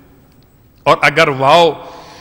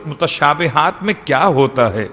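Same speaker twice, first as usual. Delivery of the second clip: ordinary delivery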